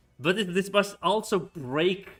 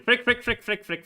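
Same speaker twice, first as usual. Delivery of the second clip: rapid, rising panicked curses; frantic cursing; 10 semitones higher, 8 dB louder than usual. Real, not acted. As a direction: spit them out in panic. Troops collapsing in battle.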